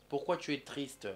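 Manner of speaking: normal talking voice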